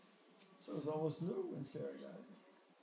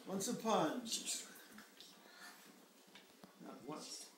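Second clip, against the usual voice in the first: gentle storybook reading; reading to a toddler; 2 semitones higher, 3 dB louder than usual. A narrator's reading. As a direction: read it like a bedtime story. Reads the picture book aloud.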